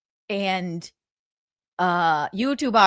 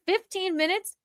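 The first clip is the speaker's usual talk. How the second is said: high, rising disbelief; incredulous question; 9 semitones higher, 6 dB quieter than usual. Real, not acted. Host reacting to the fifteen minutes, incredulous. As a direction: lift it up into an astonished question.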